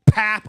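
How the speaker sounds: loud, high impact sound; explosive mock smack; imitated punch noise. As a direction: burst it out like a fist connecting. Imitates second uppercut landing.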